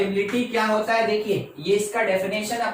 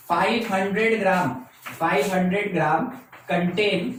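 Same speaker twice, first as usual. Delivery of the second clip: very slow, word by word, dictating notes to the class; unhurried classroom dictation with repeats; much slower than usual. Shown as a demonstration. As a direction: spell it out slowly so students can write.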